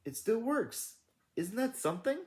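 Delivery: high, thrilled character voice; excited marveling. A narrator's reading. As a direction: marvel with the pitch way up. Tooty marvels at the working lamp.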